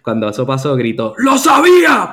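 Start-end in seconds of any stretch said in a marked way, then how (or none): 1.2-2.1 sudden high yell of triumph; agitated shout. Retold or imitated. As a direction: shout it out like you knew it all along. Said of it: "pero bien agitado". (grito agitado de triunfo)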